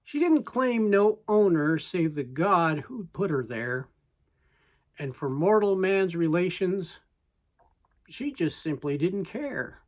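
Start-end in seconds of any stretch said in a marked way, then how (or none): none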